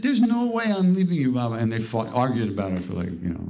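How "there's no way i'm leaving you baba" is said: high, louder refusal to part; insistent. Retold or imitated. Sadhu refusing to leave Maharaj, high and insistent. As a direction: dig in, you won't let him go.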